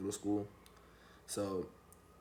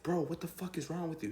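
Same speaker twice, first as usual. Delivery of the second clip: higher-pitched, disbelieving reproach; exasperated scolding; 8 semitones higher than usual. Retold or imitated. Teller confronting his friend after escape, exasperated. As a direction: demand an answer from your foolish buddy.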